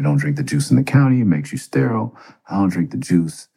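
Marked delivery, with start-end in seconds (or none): 0.0-2.1 jail warning. Retold or imitated. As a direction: pass on the jailhouse rumor urgently. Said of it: "I always hear".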